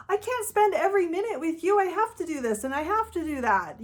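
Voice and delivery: emotional defending. Retold or imitated. Partner demanding time, answering with a high, hurt plea. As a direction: plead your case, voice climbing high. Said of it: "respond emotionally with things like".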